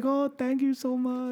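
very high, slow mock woman's voice; grateful mother. Brazilian mother thanks the man who freed her son, grateful. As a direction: gush thanks in a put-on female voice. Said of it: "She's like".

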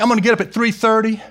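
high, loud, fast excited plans; mock overeager. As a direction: rattle off grand plans, hyped. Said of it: "because you're like".

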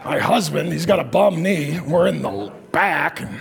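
sulky, deflated griping, lightly played; mock complaining. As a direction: moan like a weary camper stuck at the back. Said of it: "that was the verbal processing part".

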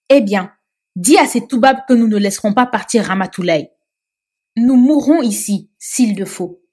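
loud, forceful refusal; loud defiance. Acted. Mame Sophie defying the police through Ndeye Touti, loud and forceful. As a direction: throw the defiance at them loudly.

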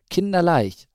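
loud, high burst of delight; young owl finding it thrillingly easy; enthusiastic. A narrator's reading. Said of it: "meinte auch der andere Bruder begeistert".

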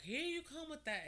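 high-pitched mock call-out; high teasing jab. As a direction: imitate a parent ribbing her, pitched well up. Parent mockingly calling her out in a story.

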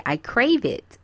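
ordinary talk

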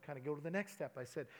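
ordinary talk, nothing marked

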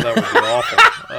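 high squeal through laughter; laughing. Real, not acted. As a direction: laugh it out in mock horror. Laughing dismay at pick.

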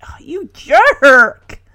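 loud, drawn-out slow rebuke; indignant scolding. Real, not acted. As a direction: stretch out the insult in disgust. Host scolds the retold father.

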